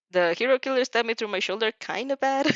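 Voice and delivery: plain reading voice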